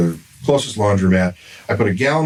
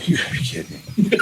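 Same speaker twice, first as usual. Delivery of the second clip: breathy whisper of exasperation; muttering at the bleeding dog; whispered disbelief; 7 semitones higher, 4 dB quieter, faster than usual. Retold or imitated. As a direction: hiss it under your breath. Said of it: "and I say".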